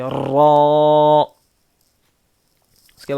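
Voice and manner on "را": a thick را with a rolled r, said louder; heavy trilled ra. Shown as a demonstration. Teacher shows the repeating r in را, trilled. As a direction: roll the r in را heavily. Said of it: "تکرار ہوتی ہے".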